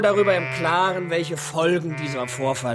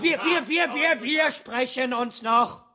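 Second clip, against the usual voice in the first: Herzanfall; 8 semitones higher, slower than usual. Acted. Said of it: "Rath greift sich ans Herz. Er stöhnt".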